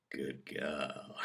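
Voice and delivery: low, very soft murmur; hushed, low approval; breathed softly. A narrator's reading. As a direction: breathe it out as intimate praise. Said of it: "he breathes".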